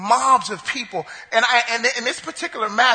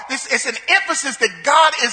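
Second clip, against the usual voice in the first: ordinary talk, nothing marked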